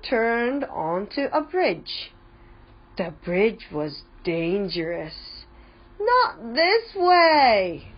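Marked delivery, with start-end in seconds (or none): 6.0-7.9 high, slow, loud warning call. A narrator's reading. Chip warning Gran at the dangerous bridge, drawn-out high call. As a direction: draw the warning out as a high cry. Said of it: "called Chip".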